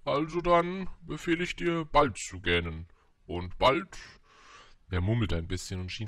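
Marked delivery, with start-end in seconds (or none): 0.0-4.0 loud royal decree, high then dropping; imperious storybook king; pompous king, vexed. A narrator's reading. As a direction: decree it grandly, slowing towards the end. Voices the king commanding.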